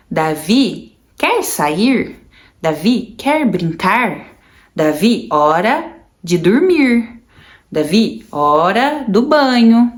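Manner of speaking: put-on slow, deliberate words for a child; slow teaching voice. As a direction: spell each short phrase out slowly to him. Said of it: "Daí eu falava".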